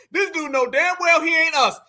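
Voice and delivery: usual speaking voice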